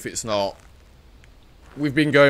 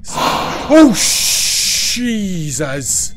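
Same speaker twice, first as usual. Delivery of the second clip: startled yelp; 6 semitones higher, 8 dB louder, much slower than usual. Real, not acted. Ghost jumps him mid-call.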